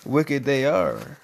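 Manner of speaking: low, slow dramatic echo; ominous echo. Acted. Parent echoing the servants, slow, low and ominous. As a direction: intone it like a spooky verdict.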